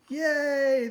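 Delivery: high, loud cheer. Acted. The crowd cheering that fuzz is gone, loud high cheer. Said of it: "the crowd cheered".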